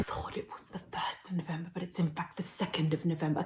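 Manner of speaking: soft unvoiced confession; hushed correction; whispered aside. Real, not acted. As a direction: whisper the mistake.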